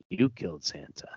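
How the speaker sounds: "you killed Santa" breathed in a soft, unvoiced whisper; menacing hushed whisper; whispered taunt. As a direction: lean in and hiss it softly. Villain's final quiet taunt.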